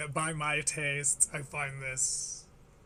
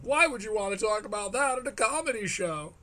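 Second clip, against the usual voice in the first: mock outrage; 4 semitones higher, 3 dB louder than usual. Mock outrage at clip's subject.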